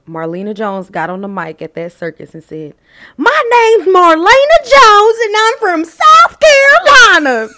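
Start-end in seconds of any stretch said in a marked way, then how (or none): 3.2-7.5 very high, loud, slow country holler; super country accent. Retold or imitated. Child on the circus mic introducing herself, loud high country accent. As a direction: belt it out in country twang, vowels stretched. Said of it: "That's country".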